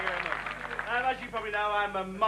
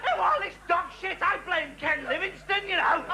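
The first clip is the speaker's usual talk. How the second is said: higher, droning bore voice; mock bore; 7 semitones higher than usual. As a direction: play the pompous idiot next door. Impersonating bores.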